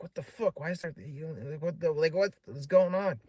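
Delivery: high-pitched flustered stammering, imitated; retold freak-out. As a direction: stumble through a mock bewildered protest, pitched high. Retold freak-out after going inside.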